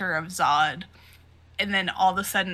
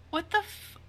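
shocked; 5 semitones higher than usual. Acted. Everyone reacting in horror.

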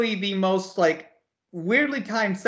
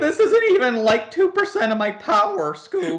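Shaggy; 11 semitones higher than usual. Acted. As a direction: do a goofy cartoon sidekick, pitched way up. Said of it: "Ultra Instinct Shaggy".

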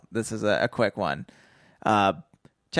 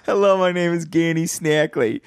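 put-on sinister greeting, louder; mock villain intro; 5 semitones higher, 4 dB louder than usual. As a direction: introduce yourself like a cartoon crook.

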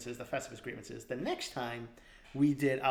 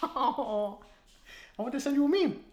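put-on whiny childlike voice; 12 semitones higher, much slower than usual. Childlike plea.

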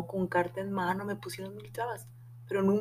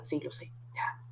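whispered reply; 5 semitones lower, 11 dB quieter than usual. Retold or imitated. Amiga responde conteniendo emoción.

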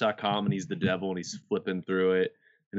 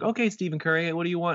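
higher mock saleswoman; 9 semitones higher, faster than usual. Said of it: "she's like".

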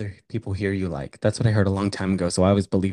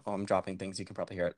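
mumbled aside, much softer; quiet aside; 3 semitones lower, 11 dB quieter, much faster than usual. Real, not acted. Aside while fumbling mics.

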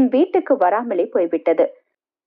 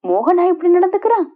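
much higher, astonished question; incredulous question; 8 semitones higher, faster than usual. Acted. Friend hears of Mohan's affair, incredulous. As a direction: voice lifts right up in disbelief.

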